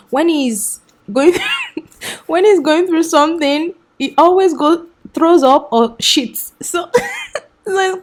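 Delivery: amused, voice lifting into giggles; laughing. Real, not acted. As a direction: struggle to get the story out while laughing. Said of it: "I'm laughing right now".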